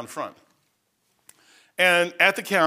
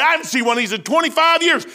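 higher and louder retold shout; mock loud veteran; 12 semitones higher, 5 dB louder than usual. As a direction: belt it like an excited old sailor. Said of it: "this is the way he's talking".

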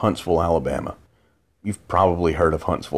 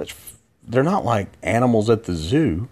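usual speaking voice

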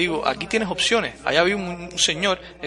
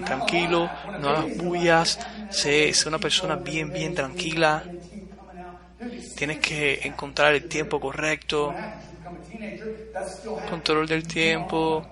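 parents calming; 5 dB quieter, slower than usual. Retold or imitated. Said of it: "mis padres ... Me decían".

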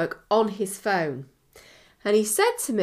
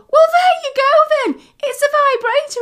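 loud, high triumphant insistence; loud, high know-it-all mum; insistent mum; 14 semitones higher, 7 dB louder than usual. Retold or imitated. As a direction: declare it loudly, high.

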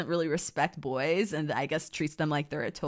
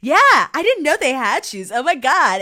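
higher, louder mock-excited chatter; excited girl chat; 8 semitones higher, 7 dB louder than usual. Viola and Olivia bonding over shoes, mock excited gushing. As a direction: imitate two girls gushing over shoes.

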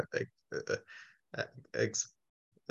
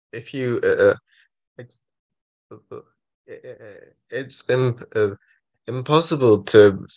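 stuttering. Real, not acted. Stuttering speaker explaining acceptance.

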